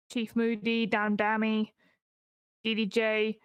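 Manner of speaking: ordinary talk, nothing marked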